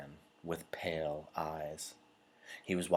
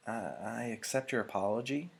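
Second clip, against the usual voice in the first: shakily; 6 semitones higher than usual. A narrator's reading. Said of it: "Jonas replied shakily".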